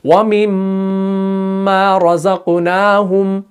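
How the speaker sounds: व मिम्मा रज़क़नाहुम slow, the mm of मिम्मा held and nasal with lips closed; recited ghunna. Shown as a demonstration. Teacher reciting the verse to show meem ghunna. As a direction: hum the doubled m in मिम्मा. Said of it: "जैसे हम पढ़ते हैं ना".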